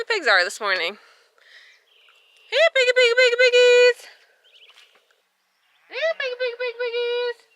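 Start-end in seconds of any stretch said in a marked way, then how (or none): 2.5-4.0 high, loud singsong pig call; high sing-song hog call, full voice. Real, not acted. Calling pigs in pasture. 5.9-7.5 calling the pigs. Real, not acted. Calling pigs in pasture again.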